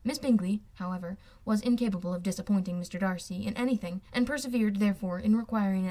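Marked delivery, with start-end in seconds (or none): none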